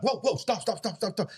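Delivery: high, rapid-fire mock-alarmed stops; alarmed interruption. Boyfriend halting girlfriend who suddenly speaks Russian, mock alarm. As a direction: act out someone urgently cutting in.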